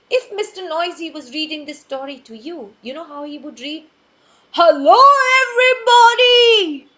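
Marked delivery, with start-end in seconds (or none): 4.6-6.9 very loud, high booming greeting; booming shout. Pretend. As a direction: boom it out like a giant. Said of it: "this is how he would talk because he's Mister Noisy".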